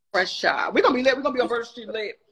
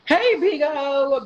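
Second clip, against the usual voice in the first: calling out; 6 semitones higher, 9 dB louder, much slower than usual. Real, not acted. Calling out to a friend.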